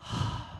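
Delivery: soft, breathy sigh; wistful sigh. Acted. Eaglet longing to fly, wistful sigh. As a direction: let out a quiet longing breath.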